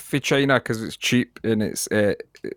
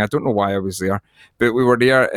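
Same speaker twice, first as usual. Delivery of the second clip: usual speaking voice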